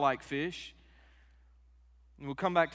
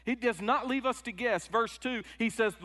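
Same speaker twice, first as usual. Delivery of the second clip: ordinary talk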